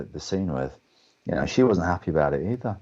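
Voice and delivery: ordinary talk, nothing marked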